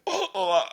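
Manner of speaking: sudden high, loud choking gasps; a very high, loud retold choke; imitating toddler choking. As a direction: mimic a child gagging on something. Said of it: "she looked up at me and went".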